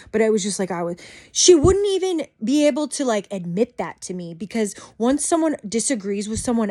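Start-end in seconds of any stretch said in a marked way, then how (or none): none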